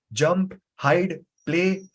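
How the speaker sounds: normal talking voice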